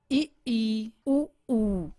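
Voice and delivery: ई then ऊ, each repeated, drawn out softly; vowel contrast drill. Shown as a demonstration. Teacher drilling vowel sounds for students. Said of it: "ये समझ लीजिए".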